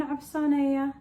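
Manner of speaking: ordinary talk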